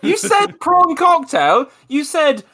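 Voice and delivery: high, slow mock-accusing voice; hammed-up, teasing retelling of his words; mocking accusation. Mocking Josh's words back.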